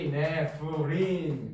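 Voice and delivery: 에피네프린 slow, syllable by syllable; drawn-out syllables. Shown as a demonstration. Teacher spelling out a hormone name for students, slowly drawn out. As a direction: stretch out each syllable of 에피네프린.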